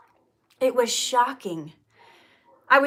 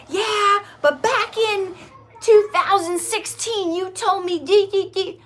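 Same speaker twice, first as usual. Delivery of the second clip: much higher, louder, mocking the grudge; mock nagging spouse; 8 semitones higher, 3 dB louder than usual. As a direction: throw the old fight back in his face. Imitating wife in a fight.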